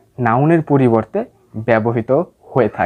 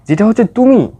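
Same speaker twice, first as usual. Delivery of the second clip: তুমি pitched high and punched louder; stress on তুমি; 6 semitones higher, 4 dB louder than usual. Shown as a demonstration. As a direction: lean hard on তুমি, then let it fall. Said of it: "জোর দেওয়ার জন্য ইট ব্যবহার". (তুমি শব্দে জোর)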